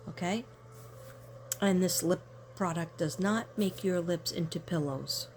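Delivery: ordinary talk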